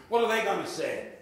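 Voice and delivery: ordinary delivery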